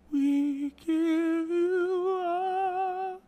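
very slow, high, drawn-out chant; mock worship leader. Worship leader at the mic, slow, high intoning. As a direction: stretch every word in a high, hushed reverence. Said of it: "they're like".

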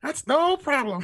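very high slow squeak. Retold or imitated. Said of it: "mimicking Sharpe's squeak".

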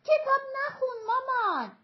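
put-on high, loud yell of a little girl; child's shout. A narrator's reading. Little daughter yells at her mother to stop reading, shouting.

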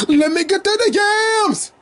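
high, stretched cheering call; excited cheer. Pretend. Cheering on a volleyball player in a high, drawn-out voice. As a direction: hype her up like a fan in the stands. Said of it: "what I say when I see her".

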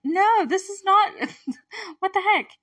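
high, loud mock outrage, slowed down; outraged disbelief. Viewer protesting the movie scene, in high mock outrage. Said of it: "And it's like".

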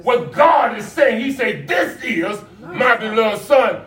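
stretched, emphatic sermon cries; full-force preaching. Acted. Pastor urging the church to hear God's son, preaching. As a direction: preach it out, drawing out key words.

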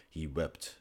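usual speaking voice

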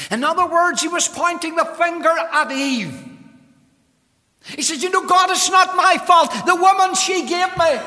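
very high, loud pulpit paraphrase; full-force preaching. Real, not acted. Preacher voices Adam blaming Eve to God, full-force preaching. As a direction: cry out the excuse in preaching voice.

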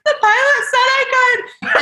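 very high, squeaking through laughter; laughing squeaky excuse. Retold or imitated. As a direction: plead helplessly while laughing. Retelling her panicked excuse.

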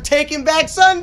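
loud, high punchline yell; shouting punchline. Retold or imitated. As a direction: belt out the punchline. Retold punchline shouted at friend.

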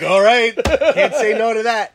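high and loud through laughter; laughing agreement. Retold or imitated. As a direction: give in laughing. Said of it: "I'm like".

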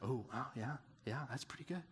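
soft, hushed murmur of being impressed; impressed onlookers. Retold or imitated. Listeners admire Paul's heritage, a hushed impressed murmur. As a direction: play the crowd, quietly wowed.

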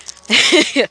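laughing burst. Real, not acted. Laugh alone on line.

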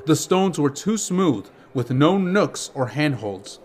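ordinary delivery

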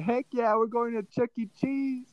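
high, thrilled squeal about Chuck E. Cheese; excited kid. Retold or imitated. Boy telling mom he is thrilled, excited high voice. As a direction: jump at the fun like a little kid.

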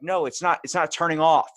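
frustrated outburst. Retold or imitated. Cook yelling at oven that won't turn off, exasperated. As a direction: snap at the beeping oven. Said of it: "I'm like".

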